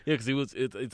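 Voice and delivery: usual speaking voice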